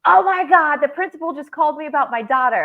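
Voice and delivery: a mock panicked rush, high and loud; panicked friend. Friend calls about the principal, imitating her panic. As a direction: play the friend in alarm. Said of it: "She goes".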